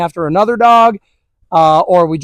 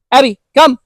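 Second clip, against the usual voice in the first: calling the dog; 5 semitones higher, 4 dB louder than usual. Real, not acted. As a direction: call the dog's name out, a bit higher and louder.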